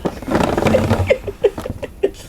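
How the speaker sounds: high, breathless laughing fit. Real, not acted. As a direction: crack up helplessly, high and wheezy. Amused by the spirits' message.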